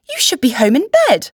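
high, loud startled scolding; exclaiming. A narrator's reading. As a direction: exclaim it in concern, pitched well up. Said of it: "I exclaimed".

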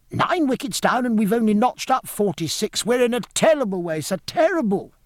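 put-on high, urgent boy's voice; high-pitched, flustered youngster; excited young gentleman. A narrator's reading. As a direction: pour out the bad news like a frantic lad. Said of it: "cried a scarlet young gentleman".

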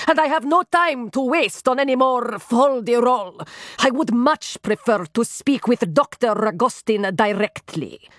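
put-on melodramatic aristocrat with a foreign accent; imperious foreign nobleman, indignant. A narrator's reading. Nobleman whose wife has vanished, to assistant, indignant foreign accent.

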